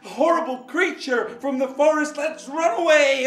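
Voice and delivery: high, loud panicked cry; frightened thieves. Acted. Thieves hearing the din cry out in high panic. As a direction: squeal in alarm and flee. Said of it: "the thieves thought".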